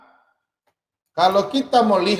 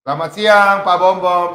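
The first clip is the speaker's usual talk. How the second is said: loud, higher phone hello; retold caller's greeting; 4 semitones higher, 8 dB louder than usual. Caller greeting the host on the phone, calling out loudly. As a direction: call out a hearty hello to the man on the line.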